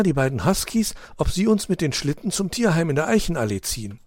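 lightly voiced excited puppy; young dog voice. A narrator's reading. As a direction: pitch the idea with excitement. Bruno's eager plan.

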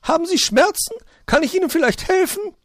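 very high, loud magpie voice; calling out. A narrator's reading. Magpie offering help from outside, calling loudly. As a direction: call your worried question in from outside. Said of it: "rief es von draußen".

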